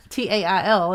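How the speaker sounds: spells out T A I L letter by letter; spelled out. Shown as a demonstration. Spelling T A I L to clear up the pun. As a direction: say each letter of "T A I L" separately. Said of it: "looked down at his tail".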